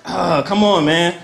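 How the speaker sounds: normal talking voice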